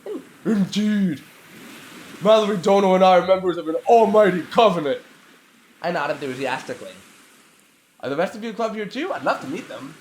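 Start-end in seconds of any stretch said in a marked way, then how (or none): 0.0-5.0 demon voice. Acted. Ramona in demon voice.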